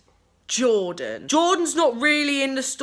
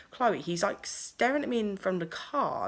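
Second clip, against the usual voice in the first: quiet warning; 2 semitones lower, 9 dB quieter than usual. Retold or imitated. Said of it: "whispering to me".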